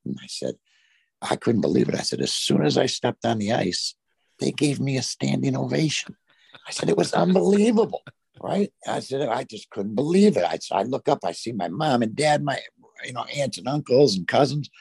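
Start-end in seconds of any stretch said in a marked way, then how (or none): 2.2-12.0 excited retelling. Retold or imitated. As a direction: voice jumps way up, amazed and breathless with disbelief. Thrilled retelling of standing ovation.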